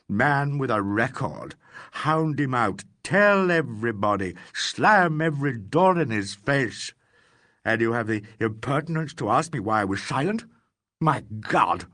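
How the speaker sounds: rising, indignant tirade, building in force; bitter old man railing at the police; outraged rant. Acted.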